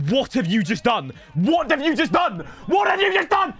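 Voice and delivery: building disbelief. Real, not acted. Gamer reacting to his player's error with rising disbelief. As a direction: escalate from asking to shouting.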